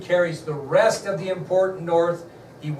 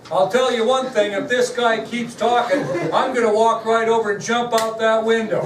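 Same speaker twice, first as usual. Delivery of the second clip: put-on loud, raised-pitch child's announcement; mock child's yell, high and emphatic; yelling little boy; 5 semitones higher, 5 dB louder than usual. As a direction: imitate a loud kid declaring it to the whole room. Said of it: "he was yelling all the time, talking loud".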